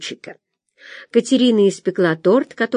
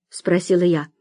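plain reading voice

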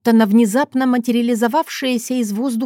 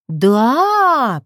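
long, swooping, higher "Да"; drawn out; 4 semitones higher, 4 dB louder than usual. A narrator's reading. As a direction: stretch the one word out. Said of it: "протянула я". (протяжно)

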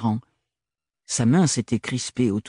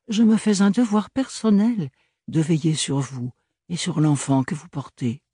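higher but steadier nobleman's voice; more serious Archibald; 7 semitones higher than usual. Acted. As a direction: drop the teasing, stay polite. Archibald plus sérieux.

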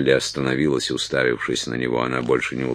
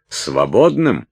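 higher, louder disbelieving question; incredulous echo; 6 semitones higher, 5 dB louder, slower than usual. A narrator's reading. Уилт echoes Салли's word, incredulous. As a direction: throw the word back in disbelief. Уилт возмущённо переспрашивает перед криком.

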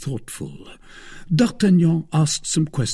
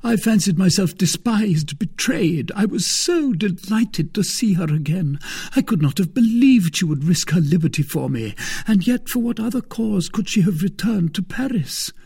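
eager, raised and joyful; excited; 9 semitones higher, 3 dB louder than usual. Acted. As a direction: rush it out in delight. Aramis overjoyed.

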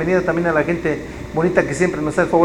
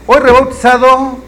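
hyping up; 5 semitones higher, 7 dB louder than usual. Real, not acted. Hyping the show title.

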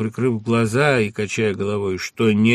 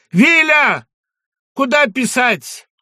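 desperate call; 8 dB louder, slower than usual. Acted. Calls after departing cart.

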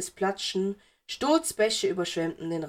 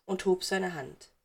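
ordinary delivery, nothing marked

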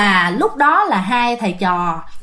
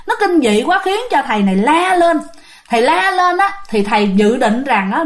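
usual speaking voice

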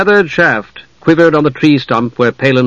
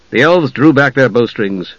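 ordinary delivery, nothing marked

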